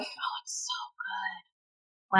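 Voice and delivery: slow breathy whisper. Real, not acted. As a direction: whisper it slowly, almost reverent. Gushing about the show.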